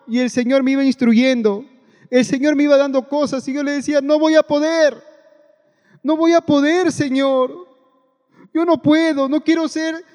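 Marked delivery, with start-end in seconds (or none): 4.0-10.0 pleading complaint. Retold or imitated. Retold protest to God.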